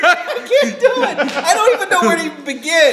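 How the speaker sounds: high, loud, laughing protest; real helpless laughter through the words. Real, not acted. Pushed to do an accent, laughing and squealing refusal.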